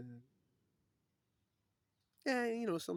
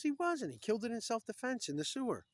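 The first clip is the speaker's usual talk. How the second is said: excited punchline; 12 semitones higher, 9 dB louder than usual. Retold or imitated. Ed delivers the punchline.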